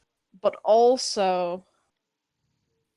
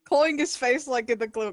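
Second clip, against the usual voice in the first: ordinary talk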